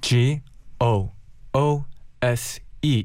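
letter by letter, very slowly; spelling it out. Shown as a demonstration. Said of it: "스펠링이 어떻게 되나요?".

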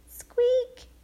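tiny squeaky mouse cry; high mouse squeak. Acted.

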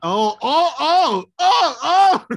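friend's mock outrage at the boast, rising shouts; mock gasp. Real, not acted. Mock shocked reaction.

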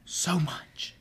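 soft unvoiced whisper. Real, not acted. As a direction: breathe it, overcome. Whispered emotional repeat.

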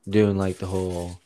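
ordinary delivery

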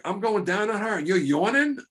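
high, loud offended squeal; mock-offended porn star; indignant quote. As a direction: act out her outrage at the yawn. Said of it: "she said something like".

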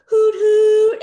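high loud hoot hoot like a trolley horn; imitated trolley horn hoot. Imitating the trolley horn in the street, a loud high hoot. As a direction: toot it like a Christmas trolley horn.

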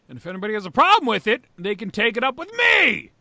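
very loud, high mock bluster; defiant challenge. Host daring critics of his editing, defiantly loud. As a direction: throw down the gauntlet at full volume.

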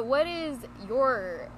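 ordinary talk

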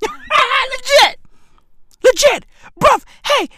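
high shouted legit with a laugh; worked-up shout; excited outburst. Real, not acted. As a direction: yell it laughing in disbelief. Laughing exclaim.

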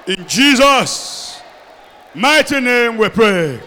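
shouted prayer, stretched and loud; preaching at full force. Acted. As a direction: pray it out at full force over the crowd.

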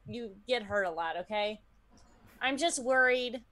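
usual speaking voice

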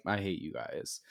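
low, very soft grumble in imitation; mock sullen mumble. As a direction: drop right down, as a sulky retold aside. Retells patient's hostility to agents.

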